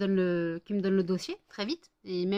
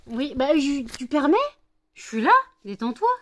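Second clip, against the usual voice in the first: louder, higher protest to the dog; exasperated outburst; 5 semitones higher, 10 dB louder than usual. Real, not acted. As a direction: tell the pushy dog to calm down. Pushy dog.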